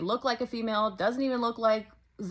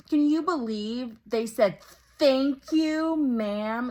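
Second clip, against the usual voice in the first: mock indignation, louder on thank you; indignant; 2 semitones higher, 5 dB louder, slower than usual. Mocking the TikToker's indignant complaint about Taco Bell. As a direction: mimic their outrage at being called ma'am. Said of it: "the indignant way this person is like".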